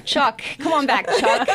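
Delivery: lightly put-on Southern trucker twang; CB trucker accent. Trucker hailing Chuck over CB radio, in a Southern accent. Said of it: "have to have the accent".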